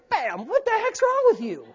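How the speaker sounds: high-pitched mock exasperation; playful scolding. God coming up beside a believer, playful high-pitched rebuke. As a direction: jolt them with an incredulous, high-voiced scolding.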